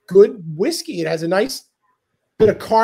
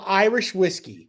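normal talking voice

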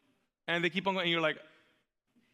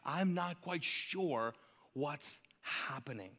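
slow, much softer and lower, fading out; weary, barely-there uncertainty; lost and unsure; 5 semitones lower, 7 dB quieter, slower than usual. Acted. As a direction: let the doubt drain the voice away. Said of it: "And we find ourselves like".

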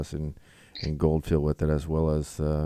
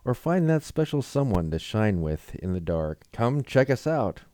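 ad read; 4 semitones higher than usual. Acted. Reads a dating site ad.

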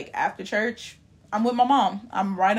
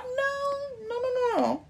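high, soft, drawn-out protest no; emphatic no; 6 dB quieter, slower than usual. Real, not acted. Denying to older saints that her generation dislikes God, high and emphatic. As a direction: shut the idea down with a sung-out no. Rejecting elders' claim about God.

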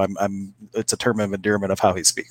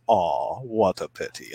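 put-on German accent; 3 semitones lower, 5 dB quieter, slower than usual. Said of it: "so when I do the accent".

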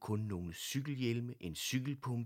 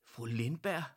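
surprised; 5 semitones higher, 5 dB louder than usual. A narrator's reading. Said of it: "fik så et overrasket udtryk".

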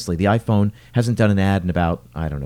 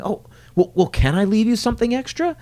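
higher, eager voice of a delighted diner; overjoyed customer offers extra; giddy offer; 10 semitones higher than usual. Retold or imitated. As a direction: bubble over with gratitude.

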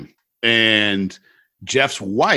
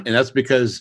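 ordinary talk

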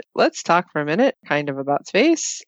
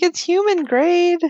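high-pitched; 12 semitones higher than usual. Retold or imitated. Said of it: "I'm like".

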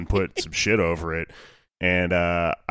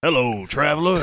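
Fallout radio guy; 6 semitones higher than usual. Retold or imitated. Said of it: "the radio guy in the first Fallout game".

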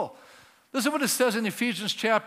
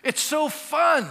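slow, loud, gleeful exclaim; delighted; 6 semitones higher, 3 dB louder, much slower than usual. Real, not acted. Preacher tells the congregation how fun it is, gleeful. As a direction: throw it out to the crowd with joy.

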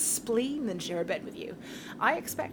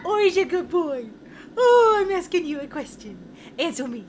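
put-on high, loud doting baby talk; 5 semitones higher, 6 dB louder than usual. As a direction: coo at a pet.

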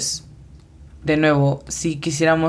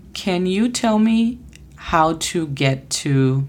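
slow model phrase; slower than usual. Shown as a demonstration. Teacher modelling an English phrase slowly for students. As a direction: say "Can you tell me" slowly for the learners. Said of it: "la siguiente frase entonces es".

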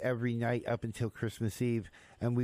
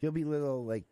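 normal talking voice

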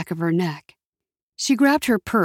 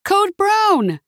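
high, loud yell; yelled alarm; 12 semitones higher, 4 dB louder than usual. A narrator's reading. Someone yells a code in the store, yelled alarm. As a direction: yell out a warning, pitch shooting up. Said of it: "Someone yelled".

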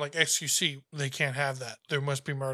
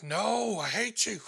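high-pitched mock outburst of hate; mock angry refusal; 6 semitones higher, slower than usual. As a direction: play an exaggerated, petulant rejection. Retold streamer snapping at the child.

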